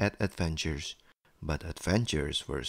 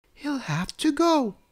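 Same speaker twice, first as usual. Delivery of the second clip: high, decisive storybook mum; mother's voice. A narrator's reading. Mummy tells Daddy the guest must leave, high mother's voice. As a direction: voice a mother ruling, pitched well up. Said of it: "said Mummy to Daddy".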